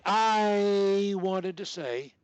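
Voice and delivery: slow held-sound demo. Shown as a demonstration. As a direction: stretch "I" long, then "wanted to say" slowly.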